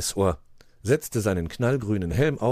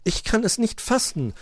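high-pitched amazement; astonished; 13 semitones higher than usual. A narrator's reading. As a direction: marvel in a raised voice. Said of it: "staunte Puh".

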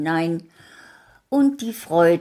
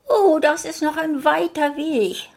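sigh; 13 semitones higher, 5 dB louder than usual. Acted. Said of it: "seufzte das Rotkäppchen".

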